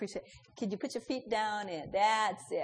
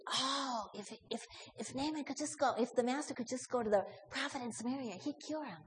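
hushed hopeful thought; 7 dB quieter than usual. Acted.

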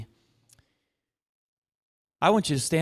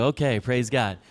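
ordinary talk

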